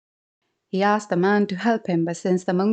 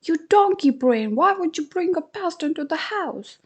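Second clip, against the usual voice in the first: shrill higher scolding; scolding wife; 9 semitones higher than usual. Acted. Wife scolding husband for bringing a pest, sharp. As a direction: tell off a foolish husband.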